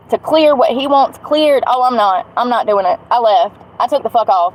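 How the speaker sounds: normal talking voice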